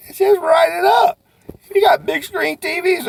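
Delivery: high, loud coach's shout; shouted pep-talk line; emphatic shout. Real, not acted. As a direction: drive the point home at full volume. Emphatic sales coaching point.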